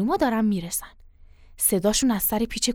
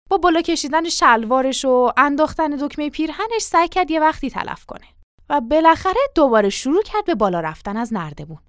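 usual speaking voice

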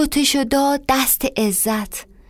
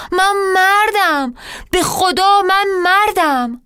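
crying out; 6 semitones higher, 4 dB louder than usual. Acted. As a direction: wail that she's dying.

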